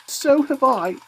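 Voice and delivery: put-on young child eagerly chiming in; excited exclamation. A narrator's reading. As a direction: pipe up eagerly. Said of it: "exclaimed another".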